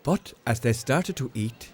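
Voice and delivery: ordinary delivery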